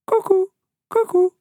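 very high, hooted two-note bird call; cuckoo call. A narrator's reading. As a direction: hoot it like a cuckoo. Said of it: "They listened again".

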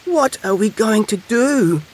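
high, slow, plaintive little hedgehog; sniffling upset. A narrator's reading. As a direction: wail helplessly to your friends. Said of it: "sniffed Little Hedgehog".

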